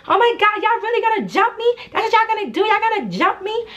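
very high, whiny imitation of disbelief; mock outraged protest. Staircase accusing them of jumping her, high mock outrage. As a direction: mimic the rival's indignation way up high.

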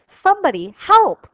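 high, loud desperate plea; crying out. A narrator's reading. Rabbits caught by the terrible things, high loud cry. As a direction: call out with nobody left to hear. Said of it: "they cried".

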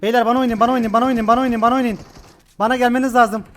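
urgent loud call to teammates; calling out loudly. Real, not acted. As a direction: shout for backup.

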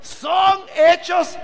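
slow, ringing pulpit proclamation, every phrase hammered home; preaching at full force. Acted. Preacher to his congregation about the new creation, full-force preaching.

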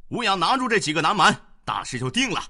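high, loud, fast command; commanding order. A narrator's reading. Enemy commander ordering his generals, loud and fast. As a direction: bark it at the officers. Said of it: "吩咐道".

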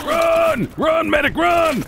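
high panicked yells; urgent shouting. Real, not acted. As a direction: yell at them to run. Urging teammate to flee.

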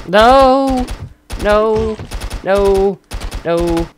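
high, loud cries of no, fading each time; shouted no. Real, not acted. Player shouting no as he gets killed. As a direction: yell it in dismay, dropping off. Dying in the game.

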